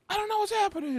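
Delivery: high-pitched bewildered wail; retold bewildered cry. Acting out the confused guy for the co-host, high cry. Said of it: "And he goes".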